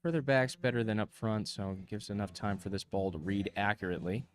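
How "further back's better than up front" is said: ordinary talk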